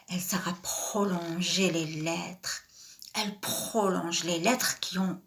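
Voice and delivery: soft, slow whisper to the class; a hushed reminder to the pupils; whispered rule. Shown as a demonstration. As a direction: whisper it slowly, as a secret. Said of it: "teacher whispers the rule".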